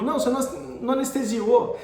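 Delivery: imitating an exasperated professor; retold reproach. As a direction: accuse the student. Professor scolds the student, retold.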